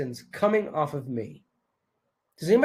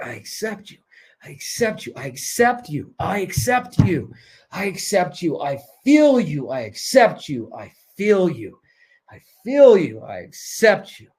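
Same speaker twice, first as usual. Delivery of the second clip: heartfelt mantra; 3 dB louder than usual. Shown as a demonstration. Coach leading the group to say it from the heart, building. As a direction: chant it with growing conviction. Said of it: "Say it with your heart".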